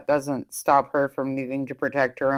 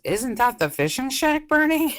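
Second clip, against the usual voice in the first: alarmed; 10 semitones higher than usual. Retold or imitated. Quoting a character's alarmed line.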